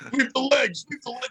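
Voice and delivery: high-pitched imitation of comics yelling a catchphrase; mock yelling. Comic mimics hack acts repeating a movie line, mock yelling. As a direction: mimic a yelled catchphrase, second one dropping off. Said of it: "They're just yelling that out loud over and over".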